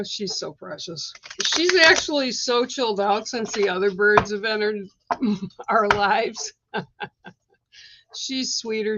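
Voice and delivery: usual speaking voice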